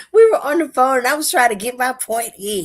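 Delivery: loud, high, rushing to get it said; retelling the phone fight, worked up and loud. Real, not acted. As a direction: caught up again in the urge to win. Excited retelling of phone argument.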